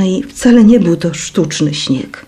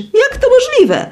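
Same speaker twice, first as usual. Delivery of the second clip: disbelief. A narrator's reading. Stunned girl's outcry.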